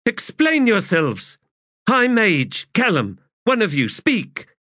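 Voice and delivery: high, loud barked orders; angry command. A narrator's reading. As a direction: tear into them. Commander rebuking her soldiers.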